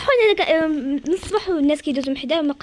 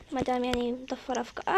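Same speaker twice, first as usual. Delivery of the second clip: ordinary talk, nothing marked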